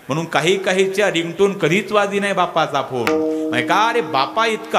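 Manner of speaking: ordinary talk, nothing marked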